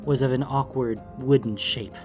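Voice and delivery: ordinary delivery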